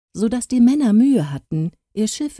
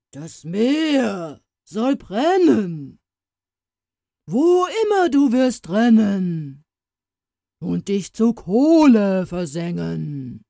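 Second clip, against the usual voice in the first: screamed curse; 2 semitones higher, 4 dB louder, slower than usual. A narrator's reading. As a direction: stretch out each word like an undead monster howling a curse. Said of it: "schrie er".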